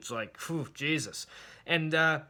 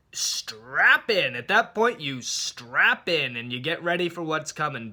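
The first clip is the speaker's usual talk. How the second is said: normal talking voice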